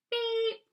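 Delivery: single high-pitched horn beep; beep sound. Shown as a demonstration. Leading children in making the beep sound, imitated horn. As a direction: give one high, held toot. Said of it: "Can you make a beep sound?".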